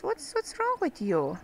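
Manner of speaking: normal talking voice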